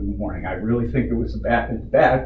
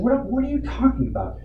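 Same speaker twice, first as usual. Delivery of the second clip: incredulous retort; 13 semitones higher, 4 dB quieter than usual. Retold or imitated. Retold argument, baffled reply to accuser.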